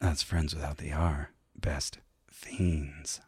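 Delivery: low, breathy whispered purr; sultry whisper. Acted. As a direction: whisper it seductively. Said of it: "getting down to sultry voices here".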